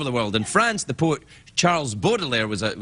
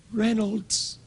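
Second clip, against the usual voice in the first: dying whisper; 6 semitones higher, 4 dB quieter than usual. Retold or imitated. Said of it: "he kept repeating the wor-, one word".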